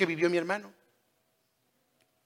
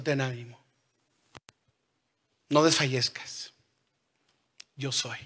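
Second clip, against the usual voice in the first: softer, gentle voice of God consoling; soft reassurance; 5 semitones lower, 7 dB quieter than usual. Acted. God telling the fearful listener, soft and reassuring. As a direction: hush it like comforting a scared child.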